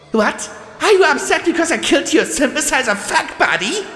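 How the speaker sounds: high, fast mocking jeer; sneering. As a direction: taunt her with contempt. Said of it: "She sneered".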